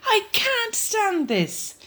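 high and slow, worn out; Mister Bear sick of the noise; exasperated Mister Bear. Acted. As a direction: drag it out in despair. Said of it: "said Mister Bear".

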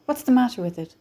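out of breath and urgent; breathless. A narrator's reading. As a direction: gasp it out in a hurry. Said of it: "George said breathlessly".

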